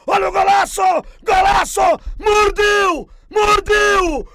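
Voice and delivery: shouting the goal call; goal commentary shout. Real, not acted. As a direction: cry out the goal as it goes in.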